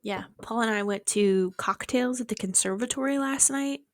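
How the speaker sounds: normal talking voice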